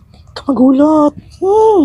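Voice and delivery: high, slow squeaky voice. Pretend. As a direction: pitch it way up, slowly. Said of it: "lalaki ako".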